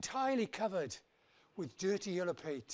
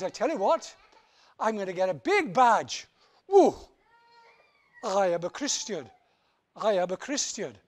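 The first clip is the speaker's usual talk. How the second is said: proud boast; 11 dB louder than usual. Acted.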